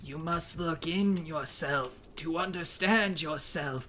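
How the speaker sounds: slow, soft wise-elder voice; measured old sage, drawing out each word; Iroh impression. Nephew imitates uncle Iroh on request, slow and soft. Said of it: "do Iroh impression again, please".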